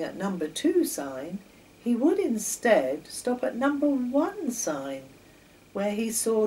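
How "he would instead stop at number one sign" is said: ordinary delivery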